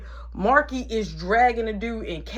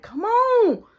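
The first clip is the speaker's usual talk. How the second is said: calling out; 7 dB louder than usual. Retold or imitated. Urging brothers back.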